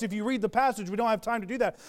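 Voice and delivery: ordinary talk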